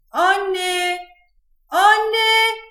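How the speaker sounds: high, held-out shout for mum; calling out loudly. A narrator's reading. Bored girl shouts for her mother from her room, calling out. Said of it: "diye bağırdı".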